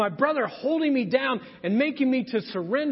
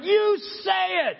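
higher, louder bullying command; big brother pinning his little brother down, a higher, louder mock yell; 10 semitones higher, 3 dB louder, slower than usual.

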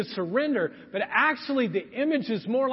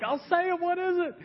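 put-on higher, desperate little-boy plea; little boy pinned by his brother, pleading in a higher voice; mock panicked kid; 9 semitones higher than usual.

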